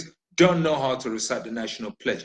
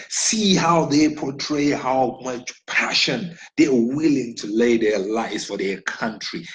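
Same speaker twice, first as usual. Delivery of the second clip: ordinary delivery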